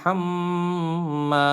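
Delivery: "হাম্মা" with a long hummed double m; very slow "হাম্মা", nasal hum then long a; ghunnah. Shown as a demonstration. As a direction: hold the m of "হাম্মা" in the nose. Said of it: "তাসদিদ থাকলে ওয়াজিব গুণা".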